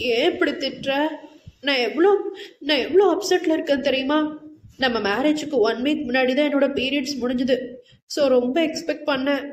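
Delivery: hurt, tearful protest. A narrator's reading. Wife upset at being scolded by husband, tearful. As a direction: plead your hurt, halting at first. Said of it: "என்றாள் மீண்டும் கலங்கும் விழிகளுடன்".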